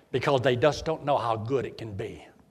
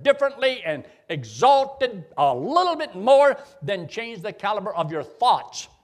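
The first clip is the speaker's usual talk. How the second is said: fervent preaching; 5 semitones higher, 5 dB louder than usual. Acted. Preaching at full force.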